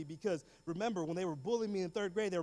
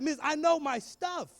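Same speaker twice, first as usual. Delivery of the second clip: high, loud, drawn-out boast; cocky pupil; 8 semitones higher, 5 dB louder, slower than usual. Retold or imitated. Pupil bragging to the teacher about adjectives, cocky. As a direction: show off loudly and slowly to the teacher.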